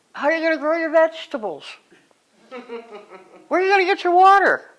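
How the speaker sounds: rising, fast, incredulous questions. Real, not acted. Mocking underground condo survival plans.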